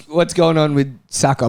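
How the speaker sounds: ordinary talk, nothing marked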